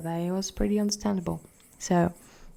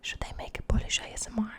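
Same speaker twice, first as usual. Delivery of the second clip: whispering; faster than usual. Acted. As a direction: breathe the goodbye in a whisper. Whispered sign-off, ASMR tease.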